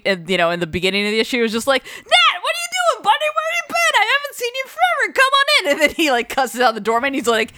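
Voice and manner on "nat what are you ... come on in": much higher, big glad hail; gushing mob greeting. Retold or imitated. Old boss hails Nat at the door, much higher and gushing. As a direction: welcome an old pal like long-lost family.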